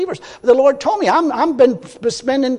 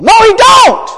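loud, high shout; shouted denial; 13 semitones higher, 10 dB louder, slower than usual. Real, not acted. Preacher shouts down the excuse for the whining minister. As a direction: slam the objection down. Rebutting an imagined objection.

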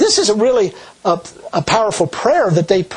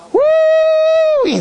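high loud woo of delight; joyful whoop; 3 dB louder than usual. Real, not acted. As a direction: whoop with joy. Joyful exclamation.

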